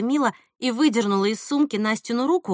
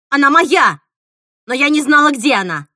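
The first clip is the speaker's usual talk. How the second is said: loud, high, furious denial; angry shout; 4 semitones higher, 8 dB louder than usual. A narrator's reading. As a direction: shout it down at once. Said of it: "крикнула Мила". (гневный громкий крик)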